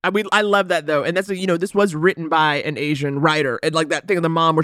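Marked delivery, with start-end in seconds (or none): none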